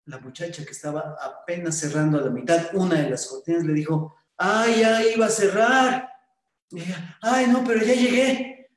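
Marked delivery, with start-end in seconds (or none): 4.4-6.1 higher, louder, slow ah; mildly surprised. Acted. Shop girl surprised by a late customer, higher and louder. 7.2-8.7 higher, louder quick exchange; eager relief. Acted. Young man relieved he arrived in time, higher and louder.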